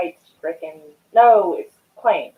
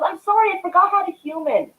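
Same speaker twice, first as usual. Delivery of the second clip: ditzy apology; 12 semitones higher than usual. Retold or imitated. Sister-in-law's retold apology.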